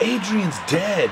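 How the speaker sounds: high, drawn-out retold outburst; imitating a friend's stunned outburst; blurted bad news. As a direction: blurt it out high, stretching each word. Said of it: "he just blurts out".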